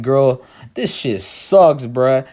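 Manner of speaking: slow, low-key fake-cool voice; playing it cool. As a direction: drag it out like you don't care. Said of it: "I just gotta play it cool".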